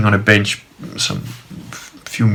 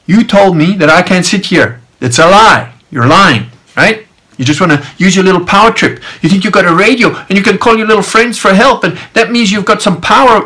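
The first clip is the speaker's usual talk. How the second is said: higher, forceful, pressing accusation; heated telling-off; 8 semitones higher, 4 dB louder than usual. Retold or imitated. Man accuses guard of power trip, heated. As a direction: retell tearing into a liar, cutting him off. Retold angry accusation of lying.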